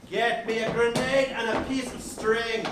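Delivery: normal talking voice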